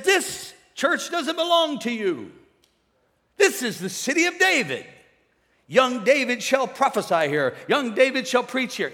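loud, high retold prophecy; prophetic proclamation. Prophet lady tells pastor the church isn't his, proclaiming.

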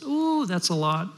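lightly voiced, wondering bunny child; a hint of an awed little kid; little rabbit. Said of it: "Little rabbit says".

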